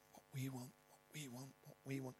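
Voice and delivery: dwindling to near silence; fading repetition. Acted. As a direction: let the phrase die away. Repeated phrase fading out.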